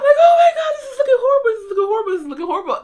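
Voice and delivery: high, loud wail that gradually sinks; hammed-up near-crying meltdown; retold absolute panic. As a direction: burst out in exaggerated panic, then wind down. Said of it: "you don't understand the absolute panic".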